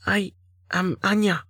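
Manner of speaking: put-on high, timid young heroine voice; small, scared and hurting; frightened young woman.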